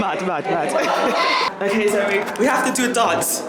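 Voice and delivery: loud, high and laughing; excited shouting. Real, not acted. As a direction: hype your friend up.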